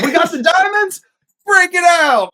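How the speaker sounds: high, loud triumphant shout; gleeful Travis. Retold or imitated. Father celebrating the diamonds, high, loud cheering. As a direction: cheer like the team just won. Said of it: "He's like".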